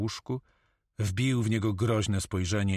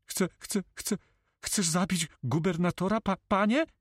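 high-pitched, stammering incredulity; frightened notary, stunned and halting; disbelief; 11 semitones higher than usual. A narrator's reading. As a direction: stutter the question in a high, shocked voice. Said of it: "zapytał z niedowierzaniem notariusz".

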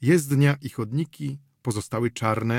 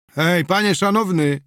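loud, higher call after him; shouted call; 7 semitones higher, 6 dB louder than usual. A narrator's reading. Innkeeper shouting after Wilczur to stop him, loud and higher. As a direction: shout after a customer leaving without paying. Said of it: "krzyknął za nim gospodarz".

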